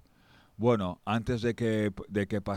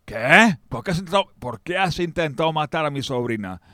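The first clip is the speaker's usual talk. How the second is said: outraged question; 6 semitones higher, 6 dB louder than usual. Acted. King outraged at confession.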